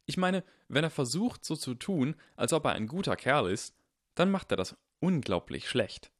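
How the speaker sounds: put-on earnest boy insisting he's right; voiced young Harry.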